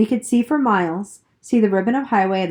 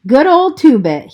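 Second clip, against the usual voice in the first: a shaky, moved cry, louder and higher; quavering voice; 7 semitones higher, 8 dB louder than usual. A narrator's reading. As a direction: voice wavering with homesickness, raised. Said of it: "he said in a quavering voice".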